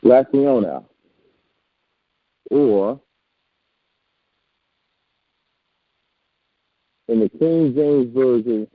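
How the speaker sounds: muffled, as if through a wall; speaking from behind a wall. Real, not acted. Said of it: "Oh, you sound muffled".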